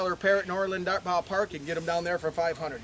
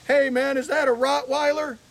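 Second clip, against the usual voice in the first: higher and louder call across the road; calling out from a truck; 7 semitones higher, 8 dB louder than usual. Retold or imitated. Truck driver calls to the man walking his bear, loud and high. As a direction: shout it from a pickup window.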